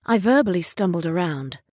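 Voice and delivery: plain reading voice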